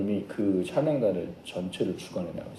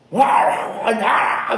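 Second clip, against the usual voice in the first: high, loud wordless hollering; mock exorcism shouting; 10 semitones higher, 5 dB louder, much slower than usual. Missionary battling a demon that will not leave, mock frantic shouting. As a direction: imitate a frantic, noisy casting-out.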